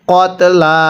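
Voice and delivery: ordinary talk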